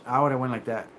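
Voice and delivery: ordinary talk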